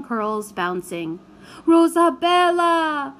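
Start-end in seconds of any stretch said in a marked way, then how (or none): 1.7-3.1 loud, high, slow beseeching call. A narrator's reading. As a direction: beg her to come back. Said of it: "beseeched Signor Maddalena".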